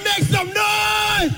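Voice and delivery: high, drawn-out shout to the crowd; rally the crowd, stretch it out; crowd hype shout. Real, not acted. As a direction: hype man calling the crowd.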